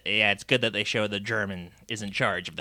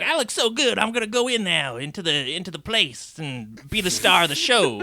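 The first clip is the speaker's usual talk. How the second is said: high-pitched, preening voice; mock self-admiring excitement; cocky cartoon character; 8 semitones higher than usual. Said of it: "Wheelie's like".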